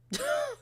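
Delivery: high squeaky laugh burst; a sharp little squeal of a laugh. Real, not acted. Laughing at her face.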